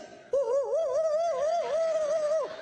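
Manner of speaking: long falsetto oohs; high drawn-out mock whimper. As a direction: squeal oohs like a scared man fleeing. Man running off, mimicked high ooh.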